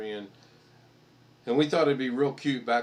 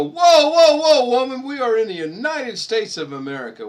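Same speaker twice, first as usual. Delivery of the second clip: much higher and louder, hammed-up protest; mock outraged shout; 12 semitones higher, 14 dB louder than usual. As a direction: yell like a cartoon patriot. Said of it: "I go".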